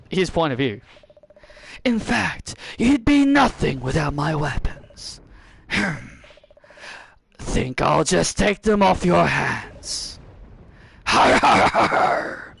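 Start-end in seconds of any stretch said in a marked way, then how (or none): 1.8-10.2 lightly voiced sly pirate, slow; scheming pirate, drawn out. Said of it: "my voice for Pirate Master". 11.1-12.6 breathy har har pirate laugh; gloating pirate chuckle. Acted. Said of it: "my voice for Pirate Master".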